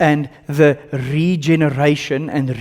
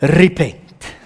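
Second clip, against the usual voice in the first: Afrikaans-accented repent; 4 dB louder than usual. Shown as a demonstration. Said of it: "because of my accent".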